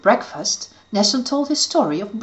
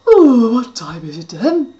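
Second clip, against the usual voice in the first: sleepy; 3 semitones higher, 8 dB louder than usual. Acted. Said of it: "said sleepily".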